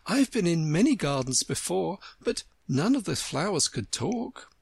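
a hint of a puzzled child; lightly voiced polite Alice. A narrator's reading. Alice complimenting the flowers, lightly puzzled.

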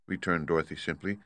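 plain reading voice

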